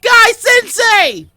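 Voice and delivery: very high, very loud drawn-out wail; wailing scream. Acted. Lee screaming for Guy Sensei at the peak of the hug, wailing. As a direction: scream it with all your heart. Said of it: "crying just as heavily".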